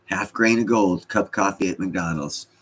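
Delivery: ordinary delivery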